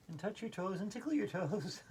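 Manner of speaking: ordinary talk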